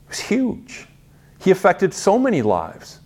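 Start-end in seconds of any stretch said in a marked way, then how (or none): 0.1-0.9 dramatic whisper. Real, not acted. Preacher stresses Joseph's legacy.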